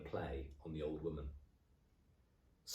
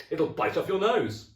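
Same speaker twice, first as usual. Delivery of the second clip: loud, high put-on alarm; loud hammed-up fright; mock-shocked exclamation; 11 semitones higher, 11 dB louder than usual. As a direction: act scared to frighten her. Husband scaring wife.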